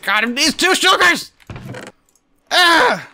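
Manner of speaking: rising high-pitched exasperated rant; frustrated yell. Real, not acted. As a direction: build up to an exasperated shout. Frustrated outburst while crafting.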